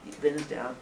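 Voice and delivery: ordinary delivery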